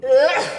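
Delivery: loud ugh of disgust; disgusted groan. Retold or imitated. Hearing the friend boast about stealing men, disgusted groan. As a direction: let out a revolted groan.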